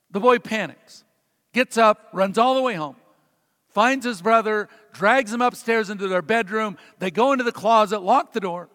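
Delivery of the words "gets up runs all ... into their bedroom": normal talking voice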